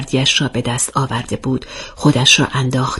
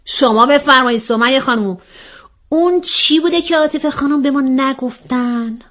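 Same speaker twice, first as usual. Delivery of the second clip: mock-official questioner; 10 semitones higher than usual. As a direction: press her with exaggerated politeness. Acts out the questioning character. (بازجویی مؤدبانه با صدای زیر)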